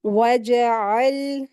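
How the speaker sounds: "वजअल" very slow, throaty ain held into a still l; correct recitation. Shown as a demonstration. Teacher showing the right reading, slow steady recitation. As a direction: draw out "वजअल" slowly and rest on the l without bouncing. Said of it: "ऐसे पढ़ेंगे".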